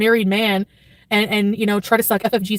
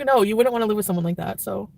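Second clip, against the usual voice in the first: usual speaking voice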